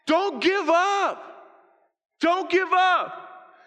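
high, loud, stretched-out plea; desperate rallying shout, each word drawn out; urgent pleading shout. Acted. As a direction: cry out to stop a friend quitting. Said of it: "Chance says".

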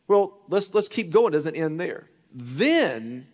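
ordinary talk, nothing marked

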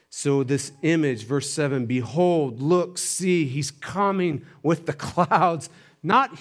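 high, emphatic pulpit proclamation; full-force preaching. Real, not acted. Preacher declaring he is coming now, full force. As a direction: drive each phrase home to the congregation.

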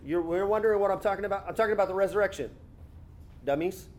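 rattled off fast, tossing in dummies; quick teasing imagined Jesus line; fast mock paraphrase. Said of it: "he could have said".

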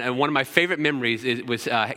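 ordinary talk

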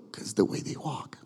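hushed, breathy whisper with a dropped voice; whispered punchline. Real, not acted. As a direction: lean in and whisper it like a secret.

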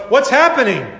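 sudden high, startled question; mock surprise. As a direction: play it caught off guard. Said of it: "all of a sudden go".

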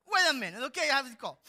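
loud, hurried aside to God; mock-urgent; hasty prayer interruption. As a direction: put God on hold. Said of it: "So I'm like".

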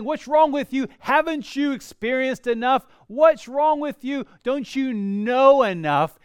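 exasperated mock scolding of the servant; mock exasperated rebuke. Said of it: "Or saying".

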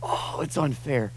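quiet, slowed lament; soft groan. Retold or imitated. Believers groaning to God in pain, hushed. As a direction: sigh it out as a weary plea. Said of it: "to go".

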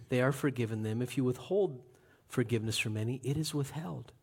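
low, hushed, solemn charge; solemn commission. Acted. Jesus sending out his disciples, low and solemn. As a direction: commission them quietly.

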